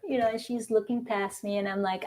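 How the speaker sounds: ordinary delivery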